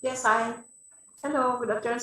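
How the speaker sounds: ordinary talk, nothing marked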